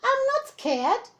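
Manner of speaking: put-on gruffalo's child insisting she is brave. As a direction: puff up brave and high. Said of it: "said the Gruffalo's child".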